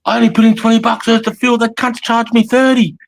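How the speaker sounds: high, loud whining complaint, the end slowed; mock complaint. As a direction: whine like a ripped-off customer. Quoting angry Facebook posters.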